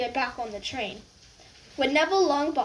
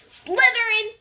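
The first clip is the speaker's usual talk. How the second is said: high, loud screamed house name; sorting hat screaming; 10 semitones higher, 7 dB louder than usual. Acted. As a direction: scream it the instant it touches. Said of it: "it screamed".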